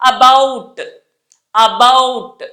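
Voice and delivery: ABOUT with the B clearly pressed; stretched careful model of ABOUT; middle B. Shown as a demonstration. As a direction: lean on the second syllable of ABOUT. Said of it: "దాన్ని మనం ఎలా పలుకుతాము".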